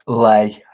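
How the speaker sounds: Lay, not Lee, called out loudly; name read as Lay. Real, not acted. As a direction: Lay said with a long ay like say. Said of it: "is that my new name for Lee now".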